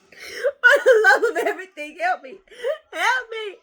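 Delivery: high, loud, laughing begging; half-laughing cry for help; mock desperate plea. Real, not acted. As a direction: plead for help while laughing.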